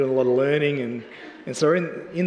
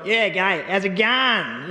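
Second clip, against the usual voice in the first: put-on Aussie accent; 4 semitones higher, slower than usual. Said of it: "you could say so many cool Aussie things".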